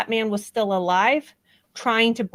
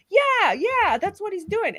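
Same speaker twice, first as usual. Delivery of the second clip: higher put-on voice, eager agreement; mocking the accused; 9 semitones higher than usual. Host imitating the accused agreeing with the detective, mock high voice. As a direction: imitate her quickly going along with it.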